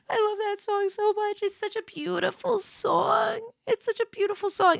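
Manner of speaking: high, softened, choked-up gush; playfully near sobbing. Pretend. Streamer gushing over a beloved song, mock tearful. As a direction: gush as if about to cry. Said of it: "I'm gonna start sobbing".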